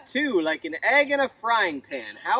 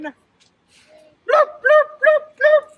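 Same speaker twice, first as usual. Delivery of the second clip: high, loud horn bloops; horn honk; 8 dB louder, much slower than usual. Pretend. Horn sound for kids.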